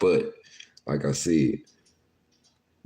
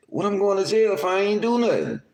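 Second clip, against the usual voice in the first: confused Lil Tootie. Retold or imitated. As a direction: play the bewildered little dude.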